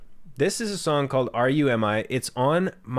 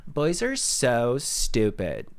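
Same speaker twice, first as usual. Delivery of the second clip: slow, drawn-out Valley Girl imitation; much slower than usual. Imitating his girlfriend's Valley Girl voice, slow and drawn-out. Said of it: "speaks in a Valley Girl voice".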